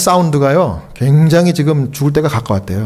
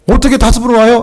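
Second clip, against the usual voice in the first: surprised protest; 8 semitones higher, 4 dB louder than usual. Retold or imitated. Pastor balks at five visits.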